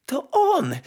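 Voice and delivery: put-on high-pitched girlish delight; joyful cry. A narrator's reading. Kitchen girl spotting the nightingale, joyful high cry. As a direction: call out the happy discovery high. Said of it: "zawołała z radością dziewczynka".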